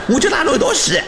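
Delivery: desperate high shout. Acted. Desperate threat in skit.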